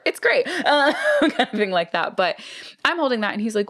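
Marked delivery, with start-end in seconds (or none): 0.1-2.4 giggling through the words; laughing. Real, not acted. Recommending a Maglite to her co-host, laughing. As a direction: laugh it off, high and amused.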